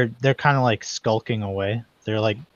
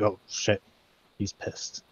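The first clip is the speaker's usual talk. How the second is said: guards muttering; 7 dB quieter than usual. Acted. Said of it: "they're like".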